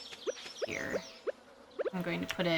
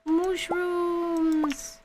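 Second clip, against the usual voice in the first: excited; 11 semitones higher, much slower than usual. Real, not acted. Finding mushrooms in the cave.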